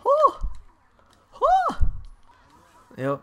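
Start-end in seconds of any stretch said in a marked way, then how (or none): 0.1-1.9 very high, short "oh" whoops; startled cries. Real, not acted. Player reacting mid-match, startled high cries. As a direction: yelp twice at the near moment.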